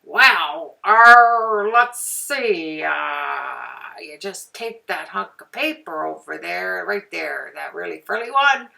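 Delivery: exaggerated, booming mock presenter voice; off-putting tutorial voice. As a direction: blare it out as an obnoxious tutorial host. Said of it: "Like if I said".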